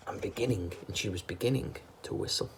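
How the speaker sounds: usual speaking voice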